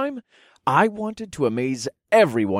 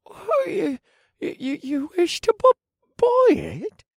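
very high stammering disbelief; stunned thief; slower than usual. Acted. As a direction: stammer out of surprise.

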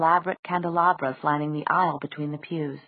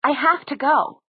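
much higher, insistent protest; shrill, rising voice; 8 semitones higher, 4 dB louder than usual. A narrator's reading. Clary demanding to go through to Idris, shrill. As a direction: push the pitch up in desperate insistence. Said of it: "Clary's voice rose, shrill in her own ears".

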